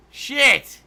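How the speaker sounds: real shout, pitch jumping up; sudden loud curse. Real, not acted. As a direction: blurt it out loud at the mistake. Game mistake, sudden curse.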